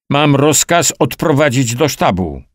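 lekko podniesiony, wyższy głos; ryk. A narrator's reading. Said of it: "ryknął Tomala". (stłumiony ryk wyższym głosem)

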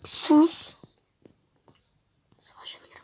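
hushed whisper. Real, not acted. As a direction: breathe it out softly. Hushing during game. (fısıltıyla)

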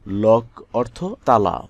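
ordinary talk

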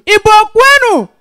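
loud, high, drawn-out crowd chant; rallying call. Real, not acted. Comedian hails Igbo people in the audience, rallying call. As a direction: call out to rouse the whole crowd.

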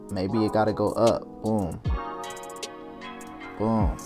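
ordinary talk, nothing marked

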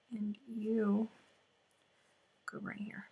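barely voiced murmur; soft whispered instruction to yourself; whispered aside. Real, not acted. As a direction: whisper to the needle as you work. Murmuring to the needle.

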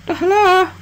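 a squeaky high cartoon hello; silly voice. Greeting the game character in a squeaky high voice.

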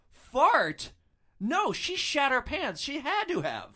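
high, loud disbelieving outburst; incredulous thought. Retold or imitated. Driver silently correcting the boyfriend, high and incredulous. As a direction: scoff at how mild the word fart is. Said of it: "I'm thinking, like".

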